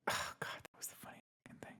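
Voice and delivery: whispered aside; whispering. Real, not acted. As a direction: whisper it under your breath.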